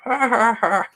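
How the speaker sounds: high, loud mock laugh; mock laughter. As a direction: imitate viewers laughing at you. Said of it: "and we can laugh".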